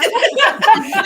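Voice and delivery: high, loud real laugh; loud high laughter. Real, not acted. Laughs at the joke.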